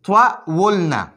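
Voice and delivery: طولنا drawn out very slowly, sound by sound; slow spelled-out demo. Shown as a demonstration. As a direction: stretch طولنا out slowly so each sound is clear. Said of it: "همدغه شان طولنا دی". (طولنا ډېر ورو او کش کړه)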